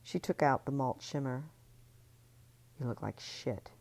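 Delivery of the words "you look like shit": low, soft self-reproach; whispered. Acted. Ellie whispering to her reflection, soft. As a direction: breathe it at the mirror. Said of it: "she whispered to her reflection".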